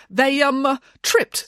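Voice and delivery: high, hesitant excuse; stall, then offer the excuse; tentative suggestion. A narrator's reading. Nanny excusing muddy children, tentatively suggesting. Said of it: "suggested Nanny Piggins".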